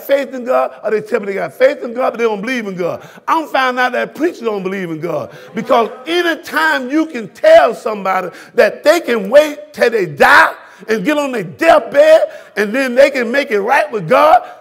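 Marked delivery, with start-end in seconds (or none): 5.5-14.5 rising sermon shout; impassioned pulpit delivery; preaching. Real, not acted. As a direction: push each line harder at the pews. Preaching climax.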